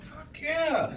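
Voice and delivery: high, stretched, delighted exclamation; thrilled high-pitched yes; excited reaction. Retold or imitated. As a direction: cheer it to yourself with a swoop up. Retold delight at seeing doctor.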